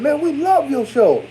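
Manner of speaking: high-pitched imitation of an admiring fan; excited fan. Fan praising the host's show in person, excited. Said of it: "this brother with his wife was there".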